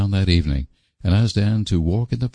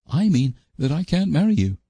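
high, pleading, desperate insistence; 11 semitones higher than usual. A narrator's reading. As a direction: repeat it with mild desperation. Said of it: "repeated Anne desperately".

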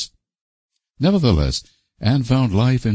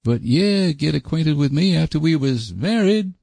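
rustic suitor; 10 semitones higher than usual. Acted. Sam argues for his proposal.

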